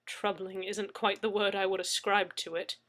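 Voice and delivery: plain reading voice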